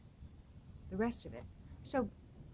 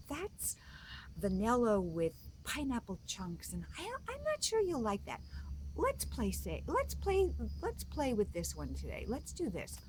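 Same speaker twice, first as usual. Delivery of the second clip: higher, swooping mom voice; coaxing mom; 3 semitones higher than usual. Acted. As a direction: gently talk a child out of it, pitch jumping up. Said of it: "And the mom goes".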